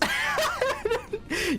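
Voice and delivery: a burst of high-pitched laughter. Real, not acted. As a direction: crack up at yourself. Laughing at Mario movie talk.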